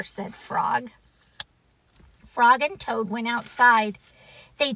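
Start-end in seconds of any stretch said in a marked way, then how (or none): none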